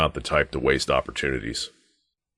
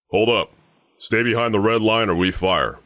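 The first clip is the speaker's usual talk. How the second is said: raised, higher-pitched guard's warning; stern challenge; 6 semitones higher than usual. Acted. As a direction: call out a firm halt to an intruder. Guard warns off an intruder.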